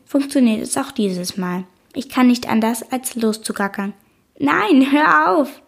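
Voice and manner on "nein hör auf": high, loud, laughing protest; giggling no stop it; laughing shout. A narrator's reading. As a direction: squeal it through giggles. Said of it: "schrei ich lachend".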